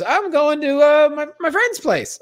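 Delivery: lightly mocking high girlfriend voice. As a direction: play her as airy and evasive. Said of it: "And she goes".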